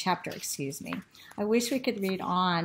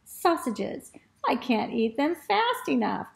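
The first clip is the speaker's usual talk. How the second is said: put-on lively, expressive children's storytelling; animated story reading; 5 semitones higher, 3 dB louder than usual. A narrator's reading. Teacher reads the dog's adventure to children, animated storytelling. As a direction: read it with big swoops and stretched key words.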